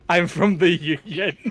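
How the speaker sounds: slow, laughing through the line; laughing delivery. Real, not acted. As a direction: savour the line while cracking up. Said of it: "always wanted to rip that".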